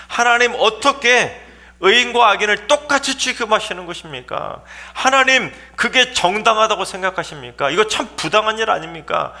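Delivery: high, heated challenge to God; agitated protest. Acted. Abraham disputing God's unfair judgment, agitated high-pitched protest. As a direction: argue your case in agitation. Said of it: "아브라함이 막 흥분해서 하나님께 막 따진".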